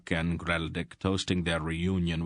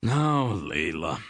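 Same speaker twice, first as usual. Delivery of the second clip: slow, sheepish mumble; a bit awkward, hushing her gently; embarrassed; 3 dB louder, slower than usual. A narrator's reading. King embarrassed by his wife's fussing, sheepish. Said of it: "King Thorek said in an embarrassed voice".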